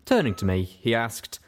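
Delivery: ordinary delivery, nothing marked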